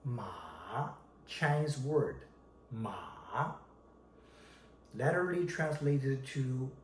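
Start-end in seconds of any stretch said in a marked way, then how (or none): 0.0-0.9 "马" dipping low, then climbing; falling-rising third tone. Shown as a demonstration. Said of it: "falling and rising tone". 2.7-3.5 "马" starting low and soft, then sweeping up; falling-rising third tone. Shown as a demonstration. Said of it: "falling and rising tone".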